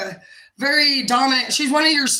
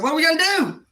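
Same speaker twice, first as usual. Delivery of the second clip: 1 anxious question; 7 semitones higher than usual. Retold or imitated. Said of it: "I said".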